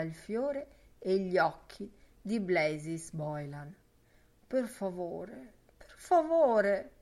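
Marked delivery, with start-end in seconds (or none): 5.7-6.9 louder, higher, slow imploring plea; pleading. Acted. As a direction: beg it, drawn out and rising. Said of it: "invocava fra ricorrenti frasi di devozione".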